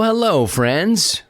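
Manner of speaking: usual speaking voice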